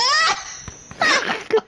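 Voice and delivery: very high squealing laugh; shrieking laugh. Real, not acted. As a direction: burst into a wild shriek of laughter. Shrieks laughing after getting hit.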